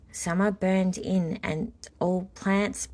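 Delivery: usual speaking voice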